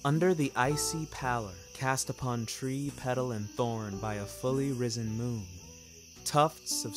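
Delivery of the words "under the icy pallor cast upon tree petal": ordinary delivery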